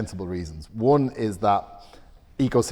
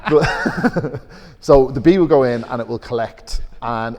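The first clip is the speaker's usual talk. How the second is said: laughing through the explanation; 3 semitones higher, 9 dB louder, slower than usual. Real, not acted. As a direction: keep laughing as you explain.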